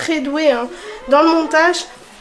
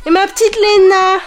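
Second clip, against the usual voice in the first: cooing; 5 semitones higher, 4 dB louder than usual. Real, not acted. Cooing to her little daughter.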